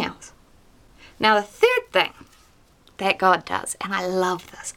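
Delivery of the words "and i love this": breathy whisper of delight; whispered aside. Real, not acted. Preacher whispers her love for the story. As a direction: breathe it like a secret joy. Aside about God's kindness.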